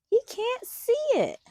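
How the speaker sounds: plain reading voice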